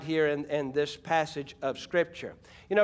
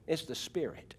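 much softer, almost to a murmur; dropped near hush; 3 semitones lower, 12 dB quieter than usual. Real, not acted. Preacher drawing the church in, hushed. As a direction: pull the voice way down, intimate.

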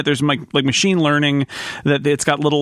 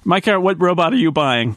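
ordinary talk

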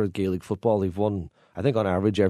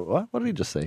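high-pitched puzzled what; baffled listener; 7 semitones higher than usual. Acted. Baffled listener reacting to an odd blessing, puzzled. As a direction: react as if you misheard, pitch jumping up. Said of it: "take a blank stare".

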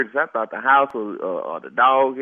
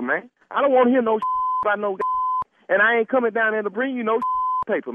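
ordinary talk

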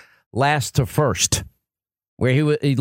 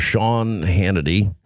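much lower, slow radio host voice; Rush Limbaugh impression; 8 semitones lower, slower than usual. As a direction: drop it low and drawn out. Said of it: "No, this is Rush".

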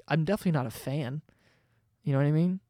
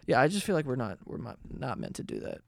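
ordinary delivery

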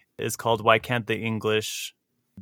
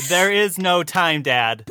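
slow, higher, playful protest; mock exasperation; 6 semitones higher, 4 dB louder, slower than usual. Host jokingly answering a co-host's dad, mock exasperation. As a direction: draw it out as a joking plea.

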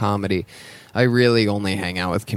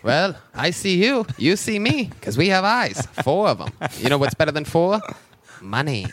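put-on old-timey voice; 6 semitones higher than usual. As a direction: play a hammy 1930s showman. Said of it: "old-timey voice".

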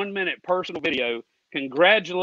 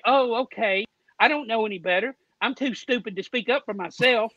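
higher-pitched mocking of meek people; mock meekness; 5 semitones higher than usual. Caller mocking fans who just take whatever they give, mock meekness. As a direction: play up a dim, resigned pushover.

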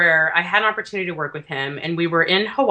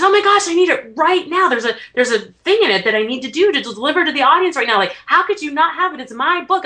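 high, urgent, louder mock panic; frantic retold boss; 8 semitones higher, 4 dB louder than usual. Tony demanding his missing book from a new assistant, high mock panic. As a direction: pitch it up like a boss who needs his book now.

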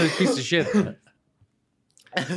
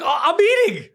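mock friend; 14 semitones higher, 5 dB louder than usual.

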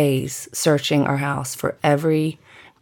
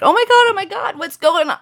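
alarmed mom; 4 dB louder, faster than usual. Retold or imitated. Mom alarmed by screaming daughter.

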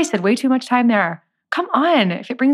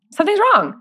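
"Something's wrong" jumps much higher, exclaimed; high exclamation; 12 semitones higher, 3 dB louder, faster than usual. Retold or imitated. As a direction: squeal "Something's" high then fall on "wrong". Friend's pep talk repeated.

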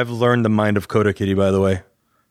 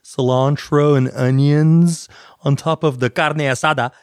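mock Mexican accent; 2 semitones higher than usual. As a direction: lean into a Mexican accent, unhurried. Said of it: "straight up Vatos Locos forever with your accent".

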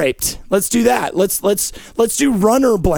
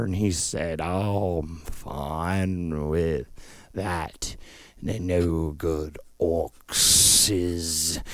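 very slow, drawn-out character voice, soft and sinking low; ponderous low, quiet drawl; slow talker; 8 semitones lower, 4 dB quieter, much slower than usual. Acted. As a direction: drag every word out, stretching the vowels. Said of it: "is you slow talking Peter".

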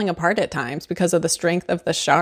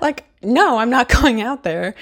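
indignant retold refusal, pitched up; mock refusal; 5 semitones higher, 3 dB louder than usual. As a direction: balk at the order, half laughing. Acting Brody's refusal to Hooper.

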